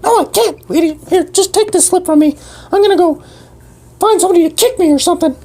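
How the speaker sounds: high fast bird voice; squeaky bird voice. Acted. Bird PD refuses help, high fast squeaky voice. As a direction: chirp it out fast.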